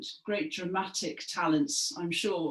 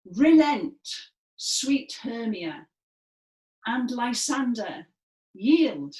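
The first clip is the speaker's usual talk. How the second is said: higher, much louder command; commanding Demetrius; 4 semitones higher, 6 dB louder, slower than usual. Shown as a demonstration. Class chorus as Demetrius, loud command. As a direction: order them to give up the game. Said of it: "being Demetrius".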